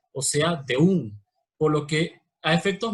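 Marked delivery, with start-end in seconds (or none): 0.7-1.2 "de un" dicho en un solo golpe de voz. Shown as a demonstration. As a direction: pronuncia "de un" como una sílaba. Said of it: "se pronuncian con un solo golpe". ("de un" en un solo golpe)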